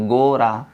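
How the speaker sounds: गोरा with a long first o; slightly stretched. Shown as a demonstration. Teacher showing how to read the word, slightly stretched. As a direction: draw out the o in गोरा. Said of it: "थोड़ा सा खींचेंगे".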